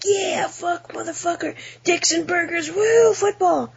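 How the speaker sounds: very high-pitched mock American whooping; hammed-up American yahoo; caricature rowdy American. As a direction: hoot it like a cartoon American sports nut, stretching the last words. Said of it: "how Americans sound to".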